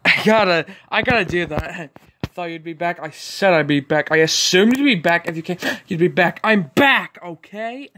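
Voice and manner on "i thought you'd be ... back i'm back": every line leans on BACK, the word "back" punched each time; mocking the clip by stressing "back"; emphasis on back. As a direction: copy the clip, hitting "back" hard at each line end. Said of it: "they're just putting emphasis on the word, um, back".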